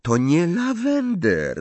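high, drawn-out indignant correction; huffy. A narrator's reading. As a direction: bristle and stretch the words impatiently. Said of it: "żachnęła się Hermiona". (z irytacją, wysoko i przeciągle)

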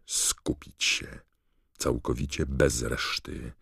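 ordinary delivery, nothing marked

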